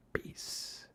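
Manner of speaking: soft whispered goodbye. Real, not acted. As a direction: breathe it out as a whisper. Whispered sign-off.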